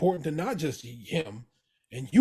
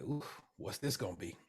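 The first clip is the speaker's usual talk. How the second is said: much softer, lower worried aside; dreading hush; 5 semitones lower, 13 dB quieter than usual. Retold or imitated. Cicero dreads what Jimmy will say, hushed. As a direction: brace for bad news, barely voiced.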